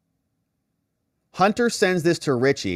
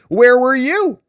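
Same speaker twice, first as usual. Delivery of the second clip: higher, louder, accusing; mock demanding shout; 11 semitones higher, 8 dB louder, slower than usual. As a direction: fling the question at her. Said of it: "Hunter said".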